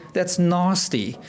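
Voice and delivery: "nasty" with a long open ah, South African style; South African accent on "nasty", slow. Shown as a demonstration. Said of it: "in South Africa, we will say".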